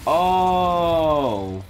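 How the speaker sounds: loud, high, drawn-out "oh"; startled cry. Real, not acted. Player accidentally setting a logo on fire, startled cry. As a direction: cry out at an accident you caused.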